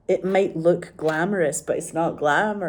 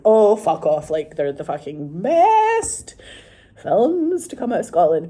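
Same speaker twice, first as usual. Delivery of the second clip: loud, emphatic fan gush; mock disbelief, louder; mock outrage; 3 semitones higher, 7 dB louder than usual. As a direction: blurt it out like a starstruck fan.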